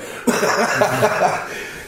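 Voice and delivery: hearty chuckle after telling the story; amused burst of laughter. Real, not acted. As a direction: laugh at your own punchline. Laughs at his own retold taunt.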